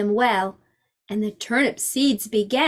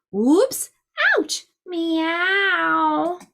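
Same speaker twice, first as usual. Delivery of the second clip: surprised yelps; 5 semitones higher, much slower than usual. Acted. All fall back.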